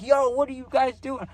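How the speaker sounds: yelling at the guys chasing the plug. Retold or imitated. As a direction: shout it at the chasers from down the road. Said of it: "And I'm yelling his name".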